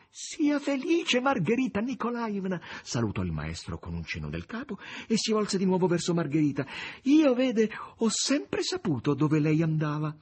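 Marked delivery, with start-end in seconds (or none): 0.1-2.6 very high, drawn-out cry; joyful cry. A narrator's reading. Natascia congratulates Margherita, crying out high and slow. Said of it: "batté le mani e gridò a Margherita". 7.0-10.1 high-pitched maid's voice, excited; excited Natascia. A narrator's reading. Natascia confides in Margherita, high and excited.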